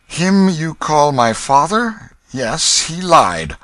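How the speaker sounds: high, slow, cold denial; flatly disown the man; indignant boy king. Acted. King denies the father.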